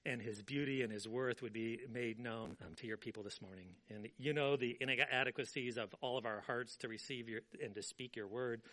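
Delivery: soft, low praying voice; prayer. Acted. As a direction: pray quietly and humbly. Leads a prayer.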